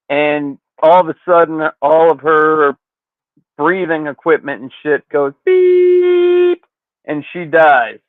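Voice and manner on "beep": long, steady high beep; monitor flatline beep. Retold or imitated. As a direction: imitate a flatlining machine with one drawn-out tone. Imitates flatlining hospital monitor.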